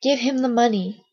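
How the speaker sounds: ordinary delivery, nothing marked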